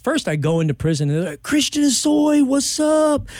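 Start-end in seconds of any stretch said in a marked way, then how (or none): 1.4-3.3 high, loud excited inmate voice; excited inmate greeting. Retold or imitated. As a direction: hype prison buddy welcoming a celebrity. Inmates greeting him in prison.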